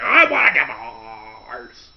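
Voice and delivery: slow, loud yell in a put-on boss voice; Vince McMahon yell. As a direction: hammer out the demand in a put-on voice. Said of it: "He's like".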